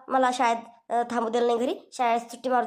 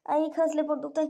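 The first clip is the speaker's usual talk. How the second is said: usual speaking voice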